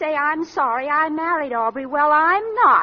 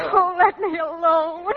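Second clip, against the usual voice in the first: slow, tearful plea; crying; slower than usual. Acted. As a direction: beg through tears to be left alone. Daughter breaks down after accusation.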